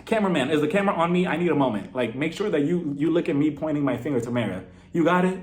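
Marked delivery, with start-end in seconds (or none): none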